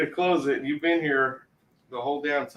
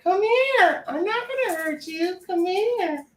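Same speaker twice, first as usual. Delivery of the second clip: high squawky parrot imitation, much higher; slower than usual. Retelling the parrot video to a friend, high squawky parrot voice. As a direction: squawk it like a bird coaxing someone. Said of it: "telling her".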